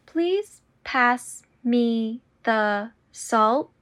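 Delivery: slow and deliberate. Shown as a demonstration. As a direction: draw out every word. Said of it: "repetition drill, slowed take".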